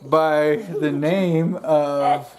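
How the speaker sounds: drawn out, laughing; slow suspense. Acted. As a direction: stretch it out teasingly. Slow teasing build-up.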